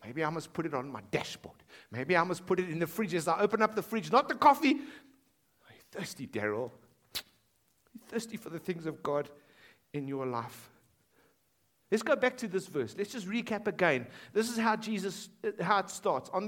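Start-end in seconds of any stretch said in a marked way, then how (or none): 4.7-10.7 slow whisper, leaning in close; whispered question. Real, not acted. Preacher asking a congregant by name, slow whisper. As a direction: ask it in a slow breathy whisper, personal and searching.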